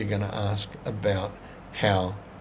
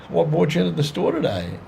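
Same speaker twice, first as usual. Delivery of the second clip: cheery shop greeting; 7 semitones higher, 4 dB louder than usual. Shown as a demonstration. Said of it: "any opening line".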